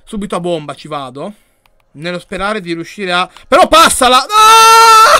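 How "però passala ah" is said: much higher and louder, ending in a raw cry; frustrated yell. Real, not acted. To his own player on screen, a furious shout. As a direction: bark at the player to pass, then explode. Teammate won't pass, goal missed.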